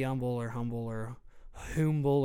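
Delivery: "humble" three ways: silent H, sounded H, then "hoomble"; varied pronunciations. Shown as a demonstration. Host offering listeners options, three pronunciations of "humble". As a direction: run through "humble" as umble, humble, hoomble. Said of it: "however you want to say it".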